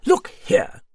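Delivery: loud, high, indignant outburst; snapped, affronted interruption; angry snap. A narrator's reading. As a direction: cut in sharply at high pitch. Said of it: "snapped Arbour".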